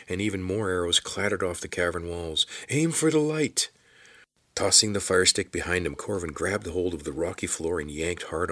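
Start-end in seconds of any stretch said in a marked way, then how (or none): 2.7-3.7 high-pitched shouted order. A narrator's reading. Soldier tells archers where to aim, shouting. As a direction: shout it out across the cavern. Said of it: "a man shouted".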